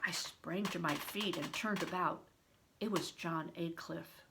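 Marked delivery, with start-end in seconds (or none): none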